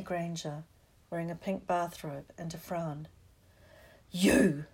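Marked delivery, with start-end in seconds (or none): 4.1-4.7 loud, angry exclamation; furious. A narrator's reading. Ron rounding on Hermione in the common room, furious. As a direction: burst out with sudden fury. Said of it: "said Ron furiously".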